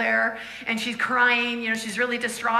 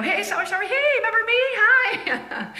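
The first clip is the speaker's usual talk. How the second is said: high, bright-eyed hello, much higher than usual; mock cheery greeting; 11 semitones higher, 4 dB louder than usual. Preacher greeting the lady at her door, mock cheery and high. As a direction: chirp it like an eager visitor at the door.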